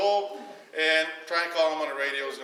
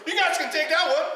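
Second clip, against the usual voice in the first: laughing quote; 12 semitones higher, 4 dB louder than usual. Retold or imitated. Bob offers the tiny boat.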